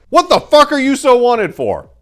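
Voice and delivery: furious shout at a frightened woman; full-throated blast. A narrator's reading. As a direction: blast the question at her. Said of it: "he yelled at Maria in a full-throated blast".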